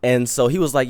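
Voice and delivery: normal talking voice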